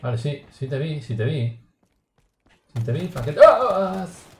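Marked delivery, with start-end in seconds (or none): 2.7-4.2 loud high taunt; taunting shout. Real, not acted. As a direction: yell it at the fleeing enemy. Taunting enemy in shooter game.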